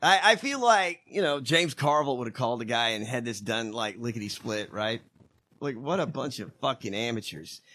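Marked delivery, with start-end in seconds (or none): none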